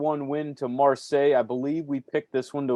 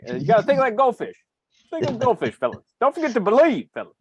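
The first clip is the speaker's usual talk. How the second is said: higher, louder Southern coach quoting Ted Lasso; Ted Lasso impression; 9 semitones higher, 5 dB louder, faster than usual. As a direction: cheer the fellas on like an upbeat Southern coach doing Ted Lasso. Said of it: "I've been watching me a lot of Ted Lasso lately".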